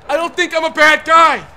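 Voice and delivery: yelling at an unfair game; exasperated protest. Real, not acted. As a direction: shout it out in aggrieved protest. Protesting betrayal by game partner.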